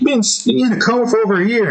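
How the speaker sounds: high-pitched Honeybee telling him the news; Honeybee's voice. Retold or imitated. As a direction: voice her a bit high, quickly. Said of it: "Honeybee is like".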